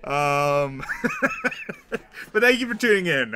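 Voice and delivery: laughing through the words; high, loud giggly talk. Real, not acted. Laughing at own joke.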